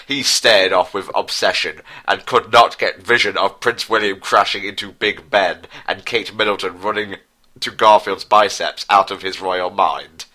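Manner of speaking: ordinary talk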